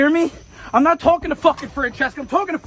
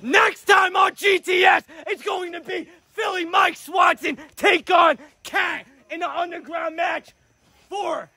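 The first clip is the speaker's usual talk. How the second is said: announcer hype; 4 semitones higher, slower than usual. Acted. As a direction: sell the main event. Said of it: "hype it up".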